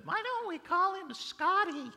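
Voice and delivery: very high, loud put-on aunt voice; imitated aunt. Aunt looking at the newborn and naming him, high mimicking voice. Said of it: "my aunt looked at me and said".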